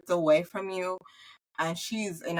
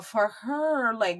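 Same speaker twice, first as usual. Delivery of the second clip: normal talking voice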